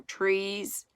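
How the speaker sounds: ordinary delivery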